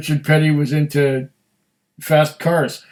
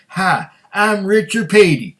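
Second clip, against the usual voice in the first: higher-pitched celebrity accent impression; Richard Petty accent; 5 semitones higher, 4 dB louder than usual. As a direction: put on the race driver's accent, vowels stretched. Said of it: "that's the way Richard Petty would talk".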